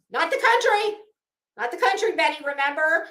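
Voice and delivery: loud, high retort; loud mocking correction. Real, not acted.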